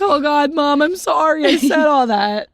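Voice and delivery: put-on loud, high childlike outburst of remorse; mock panicked apology. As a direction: blurt a hammed-up guilty apology to mom. Childhood instant remorse, acted out.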